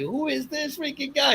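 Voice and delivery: high, slow, incredulous question; retold bafflement. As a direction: stretch it out in mock disbelief at a weirdo. Retold bafflement at the screaming stranger.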